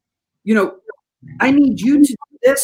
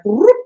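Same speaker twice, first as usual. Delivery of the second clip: magic whoop; 10 semitones higher, 4 dB louder than usual. Retold or imitated. Magic wand sound effect.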